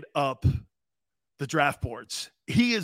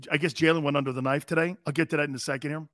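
normal talking voice

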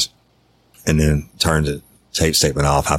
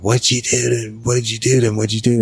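high-pitched imitation of panicked questioning; mock panic, pitch raised throughout; retold freak-out; 8 semitones higher, faster than usual. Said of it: "she started freaking out".

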